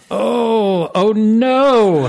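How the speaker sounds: high, loud alarmed cry; real sudden dismay. Real, not acted. GM finds task missing.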